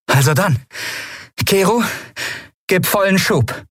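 rally them with a bright, quick voice; a young captain giving the order to go; captain Endriel. A narrator's reading. Endriel's voice, held.

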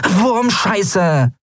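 high-pitched little curse; cursing. A narrator's reading. Nelen frustrated by the obstacle, cursing in a high voice. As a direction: swear in a very high voice. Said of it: "fluchte Nelen".

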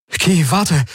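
high plea to wait; urgent, high-pitched calling out; desperate call. A narrator's reading. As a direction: beg the person leaving to stop.

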